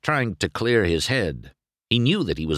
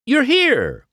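high, louder delighted shout; happy cry; 6 dB louder than usual. A narrator's reading. McQueen greeting his friends, overjoyed and much higher. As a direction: burst out with joy. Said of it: "McQueen cried happily".

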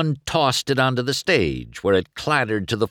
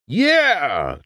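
high loud triumphant yell; hollered; 7 semitones higher, 4 dB louder than usual. A narrator's reading. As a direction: holler it as he shoots past. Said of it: "Chick hollered".

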